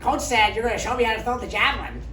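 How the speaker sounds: high, bright-eyed, eager voice of the teammate; chirpy teammate. Retold or imitated. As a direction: play the clueless eager girl, pitched high and loud. Retold teammate voiced cheerfully.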